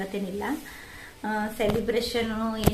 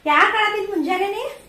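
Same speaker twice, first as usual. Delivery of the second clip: raised, high-pitched questioning; 11 semitones higher, 7 dB louder than usual. Real, not acted. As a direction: pitch it up to quiz a little one. Mother questioning child about crying.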